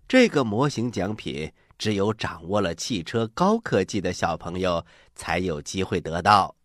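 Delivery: ordinary talk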